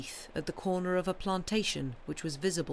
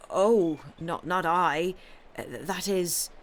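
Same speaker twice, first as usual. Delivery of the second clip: startled dismay; 5 dB louder, slower than usual. Acted. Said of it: "the milkwoman murmured, with a start of dismay".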